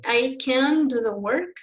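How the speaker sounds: "can" drawn out slowly as a negative can't; long stressed CAN'T. Shown as a demonstration. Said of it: "Long, not short".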